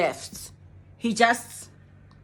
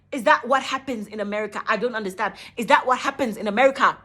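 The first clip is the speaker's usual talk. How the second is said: usual speaking voice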